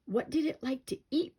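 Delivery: put-on nervous shaky kid voice; trembling with fear. A narrator's reading. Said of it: "Timmy asked in a shaky voice".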